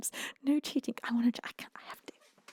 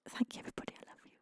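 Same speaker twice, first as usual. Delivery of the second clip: whispered thanks; 14 dB quieter, much faster than usual. Real, not acted. Tender closing thanks to chat.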